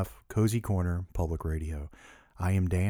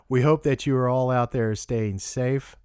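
normal talking voice

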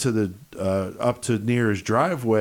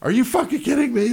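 stunned disbelief; 12 semitones higher, faster than usual. Retold or imitated. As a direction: jump up in pitch in shock. Disbelief at meeting Linda Blair.

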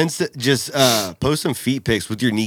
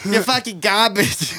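mock insult, pitched up; movie quote; 7 semitones higher than usual. Friend echoes the movie insult in a mock voice. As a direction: echo the insult in a put-on voice. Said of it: "Don John reference".

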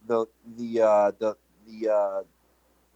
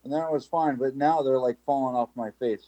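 usual speaking voice

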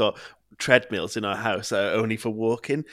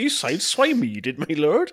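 mock formal; 5 semitones higher than usual.

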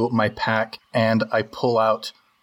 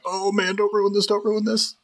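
high, mock-anxious plea; high-pitched fretting, acted for laughs; worried pleading; 10 semitones higher than usual. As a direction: play a nervous "don't mess this up" aside, pitched way up. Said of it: "looking at each other being like".

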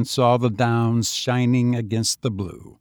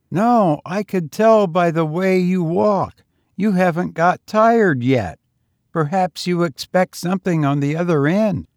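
higher, youthful lad's voice; street boy; 7 semitones higher, 5 dB louder than usual. A narrator's reading. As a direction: play the lonely young tramp, higher. Boy's voice.

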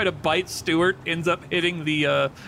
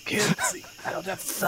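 whispery snake voice; 3 semitones higher, 5 dB quieter than usual. Acted.

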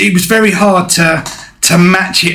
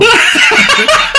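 laugh; 14 semitones higher than usual. Real, not acted. Breaks up mid song.

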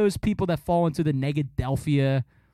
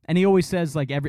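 ordinary talk

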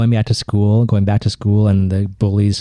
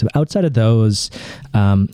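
ordinary delivery

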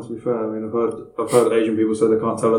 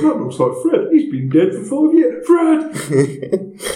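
put-on high, loud old man shouting at the telly; old man yelling; 13 semitones higher, 6 dB louder, much slower than usual. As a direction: yell like a confused old man at the TV. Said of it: "an old man just screaming at the T V".

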